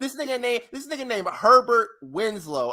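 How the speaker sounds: normal talking voice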